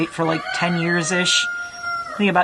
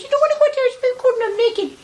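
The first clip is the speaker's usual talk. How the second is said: voicing the pet; faster than usual. Owner speaking as her pet about the cold, high little voice. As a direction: speak for the pet as a tiny complainer. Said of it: "She goes".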